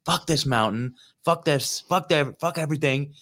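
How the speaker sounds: mock-venting at an endless climb; retold frustrated rant. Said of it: "I'm getting all bent out of shape".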